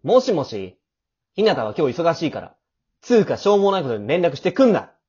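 loud, curt rebuke down the phone; cold angry snap. Acted. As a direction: shut the caller down hard. Boy snatches phone, snaps at caller. (電話の相手を突き放す怒った声)

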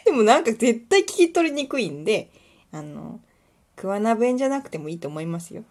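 ordinary delivery

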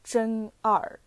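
真二 read in Mandarin, as "zhen er"; 真二 with Mandarin sounds and tones; Chinese reading. Shown as a demonstration. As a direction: pronounce 真二 the Chinese way. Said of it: "中国語読みだと".

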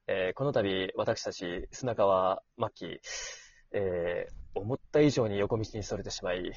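low, soft formal apology voice; good voice. Pretend. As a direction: put on a deep, solemn apology voice. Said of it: "いい声を作って".

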